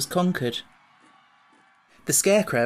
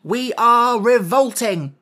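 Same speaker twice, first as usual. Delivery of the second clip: proud declaration; 8 semitones higher, 8 dB louder, slower than usual. Acted. General declares the revolt.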